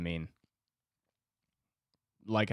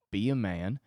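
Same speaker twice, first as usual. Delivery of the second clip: ordinary delivery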